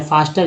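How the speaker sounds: फास्टर as "FAAS-tar"; long "aa" vowel. Shown as a demonstration. Teacher corrects F-A-S-T-E-R, long "aa" vowel. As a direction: say फास्टर with आ in the first syllable. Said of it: "ये भी आकार हो गया".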